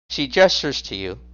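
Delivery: ordinary talk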